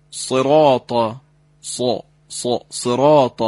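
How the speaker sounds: صراط with a thick, full emphatic ص, no whistle; heavy emphatic sad. Shown as a demonstration.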